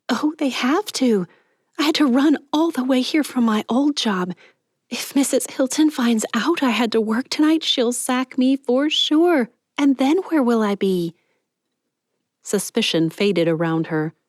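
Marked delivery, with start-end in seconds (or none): 0.1-11.1 mock-frantic pleading, pitched higher; feigned panic. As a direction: act desperate to get past, a bit overdone. Clarice fakes panic to pass.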